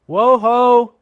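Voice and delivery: loud, high drawn-out holler; coachman's call. A narrator's reading. Coachman hollers to his horses, loud call.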